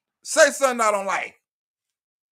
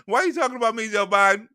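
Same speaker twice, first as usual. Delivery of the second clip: ordinary talk, nothing marked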